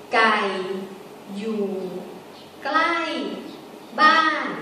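low gai, then falling klai and ban; Thai sentence tones. Shown as a demonstration. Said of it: "Klai and ban are falling tones".

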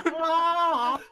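high, loud drawn-out squawk; imitated siren cry from a beak; bird-beak squawk. Game master mimics the bird's siren noise, loud squawk. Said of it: "opening your beak and going".

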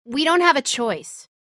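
ordinary delivery

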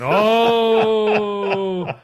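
loud, high, drawn-out crowd "ohhh"; imitated crowd reaction. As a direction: mimic a scandalized crowd. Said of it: "the whole crowd went".

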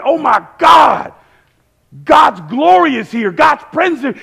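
loud, high exclamations of amazement; excited. Retold or imitated. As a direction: shout it like an overwhelmed disciple. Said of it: "he's, like, so excited".

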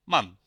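"মান" with a short, punched a; short vowel. Shown as a demonstration. Two consonants after the vowel, short a in "মান". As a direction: say "মান" briefly and firmly, vowel kept short. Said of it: "এটার উচ্চারণ হবে তাহলে short".

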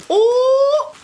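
loud, high delighted "Oh!"; surprised shout. Real, not acted. Opening a gift package, loud high surprised Oh. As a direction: burst out in happy surprise.

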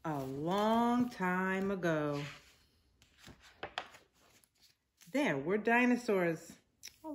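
patient picture-book narration; reading to children. A narrator's reading. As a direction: read it aloud to little kids, drawing out the words.

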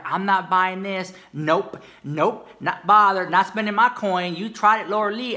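mock the haters with sass; sassy mock shopper. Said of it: "they're like".

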